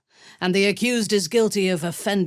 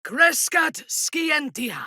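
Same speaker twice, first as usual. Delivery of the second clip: high, slow incantation; spell cry; 12 semitones higher, slower than usual. Acted. Casting in battle, high proclamation.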